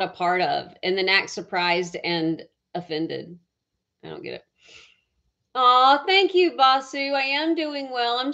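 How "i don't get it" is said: low, soft mutter to herself; muttered aside. Real, not acted. Puzzled by rude visitors, a low soft mutter. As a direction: mutter it lower and softer, fed up.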